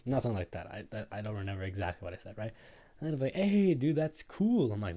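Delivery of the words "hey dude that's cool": loud, high, hyped-up praise; excited compliment. Retold or imitated. Friends seeing the new glasses, loud excited approval. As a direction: call it out to your buddy, higher and louder.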